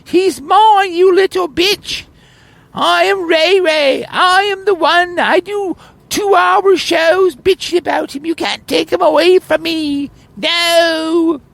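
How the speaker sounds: very high falsetto, slow and possessive; ranting Mighty Python. Pretend. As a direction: whine and rage like a jealous rival, way up high. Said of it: "rant and rave".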